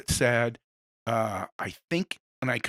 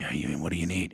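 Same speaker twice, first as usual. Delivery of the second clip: low, gruff doorman voice, lower and softer; little back-door guy; 8 semitones lower, 5 dB quieter, faster than usual. Pretend. Imagined brain doorman answering a knock, low and gruff. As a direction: play a grumpy little doorman behind a hatch. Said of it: "a little guy opens and goes".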